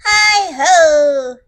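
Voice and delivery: loud, drawn-out greeting call. Acted. Hare announcing himself at Bear's door, loud call. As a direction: holler it from the doorway. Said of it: "Then they hear".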